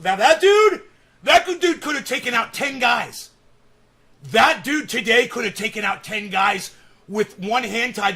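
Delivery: loud, high excited outburst; shouting it out in awe. Real, not acted. Excited about strong guy.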